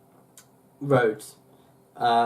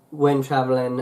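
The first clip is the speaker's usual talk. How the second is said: ordinary talk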